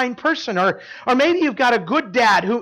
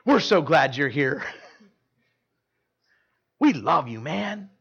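tender greeting; 5 semitones lower, slower than usual. Retold or imitated. Pastor gently welcoming the wild boy at the concert, tender. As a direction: speak gently to the lonely boy. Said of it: "I was like".

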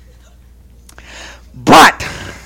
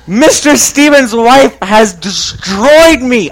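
usual speaking voice

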